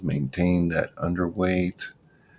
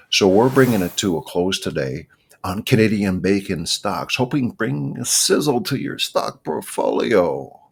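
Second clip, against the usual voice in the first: louder, higher show-host outro; sign-off announcer; 3 semitones higher, 4 dB louder than usual. Real, not acted. Host signing off the Canadian Bacon show, announcer style. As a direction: wrap up like a radio host.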